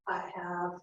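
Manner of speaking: breathy low whisper. Shown as a demonstration. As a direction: drop to a whisper. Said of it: "And lower your voice".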